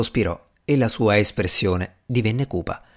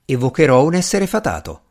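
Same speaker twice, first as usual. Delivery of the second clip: raised, emphatic short declaration; brief announcement; 5 semitones higher, 5 dB louder than usual. A narrator's reading. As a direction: announce it briefly, higher and louder. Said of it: "annunciò Strange brevemente". (annuncio breve e deciso, a voce più alta)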